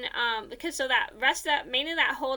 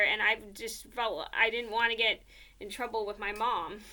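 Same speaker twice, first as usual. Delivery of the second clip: ordinary delivery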